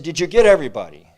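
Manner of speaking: loud eager shout toward the boats; calling out. Retold or imitated. Townspeople shouting to the returning boats, loud and eager. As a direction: holler the question across the water.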